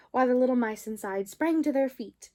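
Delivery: plain reading voice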